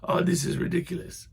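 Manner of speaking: high, breathy put-upon sigh; a half-hearted impression of an annoyed man; mock exasperation, not a real impression; lightly voiced exasperated man. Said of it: "it sounded kind of like".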